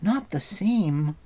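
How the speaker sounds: high, drawn-out incredulous question; astonished cry. A narrator's reading. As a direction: ask it slowly, unable to believe it. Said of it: "Taran cried".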